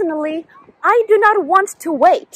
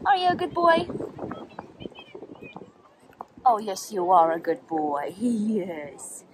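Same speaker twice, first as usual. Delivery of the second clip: soft cooing; 6 semitones lower, 10 dB quieter than usual. Real, not acted. Cooing to her dog.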